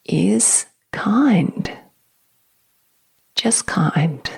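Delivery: holding back tears. Real, not acted. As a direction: voice catching on the edge of tears, hushed. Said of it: "Makes me emotional again".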